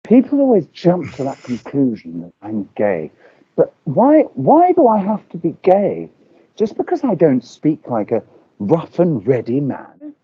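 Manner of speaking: usual speaking voice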